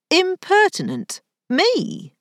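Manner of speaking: high-pitched, affronted echo; incredulous, offended squawk; indignant disbelief. A narrator's reading. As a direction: throw the insult back, voice leaping up. Indignant retort to being called impertinent.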